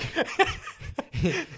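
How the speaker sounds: airy unvoiced chuckle; breathy laugh. Real, not acted. Laughing at David's reaction.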